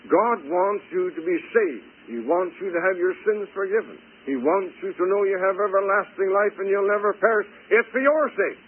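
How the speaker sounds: high, insistent preacher's appeal; fervent preaching. Real, not acted. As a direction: press it on the listeners, climbing to a strong finish. Preaches with rising force.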